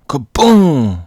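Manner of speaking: loud, high, drawn-out kaboom; big comic-book explosion noise; imitated explosion. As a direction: boom it out like a blast. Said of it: "It explodes".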